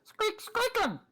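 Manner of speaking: very high squeaky toy voice; rubbery high squeaky toy. Acted. GM voices mouse Cinder squeaking.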